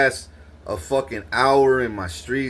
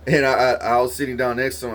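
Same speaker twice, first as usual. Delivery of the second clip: normal talking voice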